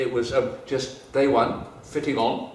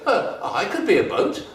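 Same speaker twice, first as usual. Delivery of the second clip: eager offer; 7 semitones higher, 6 dB louder than usual. Acted. Ding Ding offers help.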